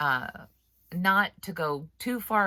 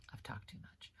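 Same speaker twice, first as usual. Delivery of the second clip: whispered, much softer and lower; whispered aside; 7 semitones lower, 21 dB quieter than usual. Shown as a demonstration. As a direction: murmur it to yourself in a whisper. Repeats the line whispered.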